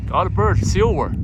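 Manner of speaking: high, loud excited shout; excited call. Real, not acted. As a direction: call out at the birds arriving. Spotting returning pigeons.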